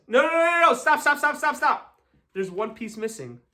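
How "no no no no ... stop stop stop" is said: high, rapid-fire repeated words; urgent halt. Real, not acted. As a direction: cut the crowd off in a hurry. Cuts off applause at missing piece.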